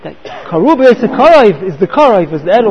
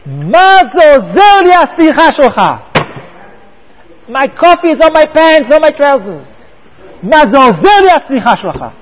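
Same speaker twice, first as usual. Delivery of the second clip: loud, raised-pitch imitation of a furious yell; retold top-of-voice scream; 4 semitones higher, 4 dB louder than usual. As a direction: shout it in outrage, acting out the angry victim. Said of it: "screamed at the top of his voice".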